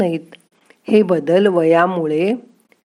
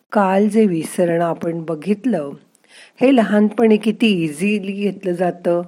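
ordinary delivery

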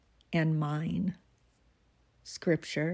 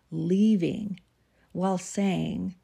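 ordinary delivery

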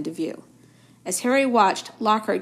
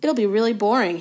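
ordinary delivery, nothing marked

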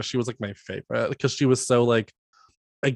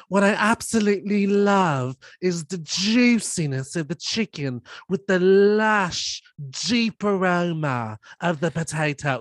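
slow, higher, sultry cooking-show purr; mock-seductive TV chef savoring every word; hammed-up sexy food-show host; Nigella Lawson; 4 semitones higher, slower than usual. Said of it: "Nigella Lawson talks very horny on her show".

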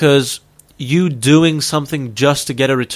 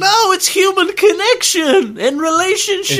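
very high, shouted mock indignation; shrieked protest. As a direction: squeal back in playful outrage. Mock quarrel, protesting loudly.

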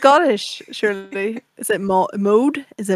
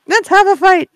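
mock challenge; 10 semitones higher, 7 dB louder than usual.

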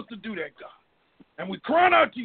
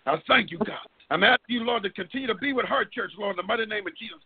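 normal talking voice

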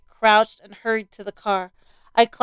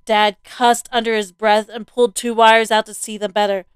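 ordinary delivery, nothing marked

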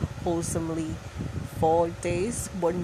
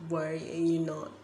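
ordinary talk

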